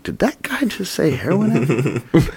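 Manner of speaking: high-pitched retold disbelief; stunned inner question, pitched high; incredulous. Retells his own shocked inner reaction.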